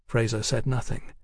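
plain reading voice

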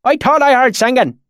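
put-on high, loud rustic swineherd accent; thick valley accent. A narrator's reading. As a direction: speak up in broad backwoods dialect. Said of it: "his accent so thick and oily".

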